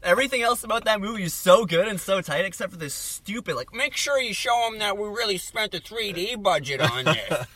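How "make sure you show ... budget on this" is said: mocking studio exec.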